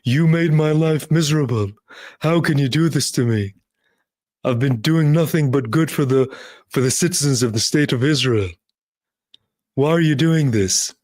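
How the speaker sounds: mock tearful Netanyahu pleading with the judge; Bibi tone. Host mimics Bibi complaining to the judge, mock-tearful. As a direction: ham up Bibi's wounded plea in court. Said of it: "in the Bibi tone".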